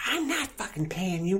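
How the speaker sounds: high-pitched imitation of an irate woman; screechy refusal, pitch way up without much volume; mock screaming. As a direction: act out her hysterical protest. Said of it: "Freaking screaming at him".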